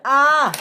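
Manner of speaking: high "ah!"; short high cry of alarm; acted scream. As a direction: yelp up high. Wind hits the engine.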